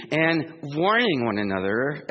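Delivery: warning leaned on, rising at the end; stress on warning. Shown as a demonstration. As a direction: put the weight on warning. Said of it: "as in W A R N I N G".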